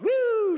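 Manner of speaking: high, loud mock siren "woo"; siren whoop. Police arrive, siren call.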